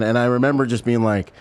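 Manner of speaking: usual speaking voice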